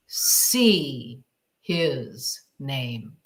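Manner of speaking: low, very slow, drawn-out voice; a low voice speaking deliberately into her ear; mysterious inner voice. Retold or imitated. Voice in her left ear telling her to see the name. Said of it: "I heard a voice in my left ear say".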